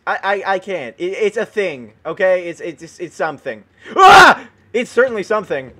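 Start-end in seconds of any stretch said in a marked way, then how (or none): 4.0-4.5 short high loud yelp; startled yell. Real, not acted. Gamer jumped by a tree spirit, startled yell. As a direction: yelp as if suddenly hit.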